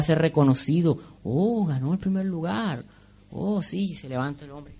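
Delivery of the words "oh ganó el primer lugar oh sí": higher, softer admiring "oh"; a small, hushed mock cheer; mock admiration. Imitating onlookers at the winner's podium, softly awed.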